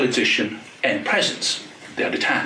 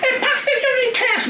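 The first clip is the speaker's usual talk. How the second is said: mocking her delight, much higher; mock excited shriek. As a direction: squeal it like she's thrilled. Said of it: "she was screaming at some point".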